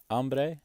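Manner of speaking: HAMBRE said as AM-bray, no H; silent H. Shown as a demonstration. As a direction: drop the H in hambre. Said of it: "you just forget about the H".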